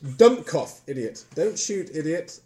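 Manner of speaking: half-hearted shout in amateur German; mock German shout. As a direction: call out commands in bad German. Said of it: "shouting something in German".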